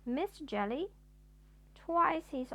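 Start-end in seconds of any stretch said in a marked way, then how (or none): none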